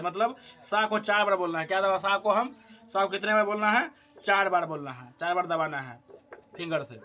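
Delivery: usual speaking voice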